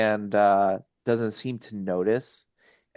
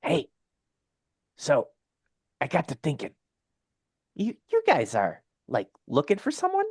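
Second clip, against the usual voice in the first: higher-pitched, tentative character voice; Felgar, sidling up with a question; 6 semitones higher, faster than usual. Acted. Game master as Felgar asking the party, tentative. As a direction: float a hesitant question, rising at the end.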